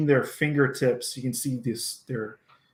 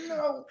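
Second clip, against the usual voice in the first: feeble moan; 6 dB quieter than usual. Retold or imitated. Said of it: "she's just going".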